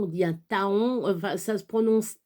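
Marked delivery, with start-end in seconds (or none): 0.3-1.1 "taon" said as two syllables, ta-on; wrong pronunciation. Shown as a demonstration. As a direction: sound the O in "taon": ta-on. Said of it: "ça se prononce pas". (« taon » mal prononcé, en ta-on)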